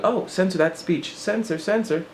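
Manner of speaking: lightly voiced inner censor. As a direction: voice the nervous censor in your head. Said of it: "I'm like".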